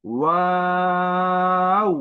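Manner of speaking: ওয়াও with a rounded w and very long, high, steady aa; rounded lips, not closed. Shown as a demonstration. Teacher shows the correct letter, lips rounded. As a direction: hold ওয়াও evenly, gliding to oo without closing the lips. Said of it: "আমি ঠোঁট গোল করেছি".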